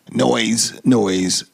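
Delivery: ordinary delivery, nothing marked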